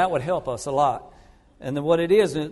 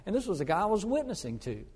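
usual speaking voice

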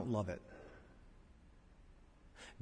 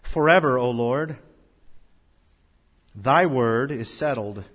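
slow, measured reading of scripture; solemn verse reading; 6 dB louder, slower than usual. Shown as a demonstration. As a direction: read each phrase slowly and solemnly. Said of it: "Look at verse eighty-nine of the text".

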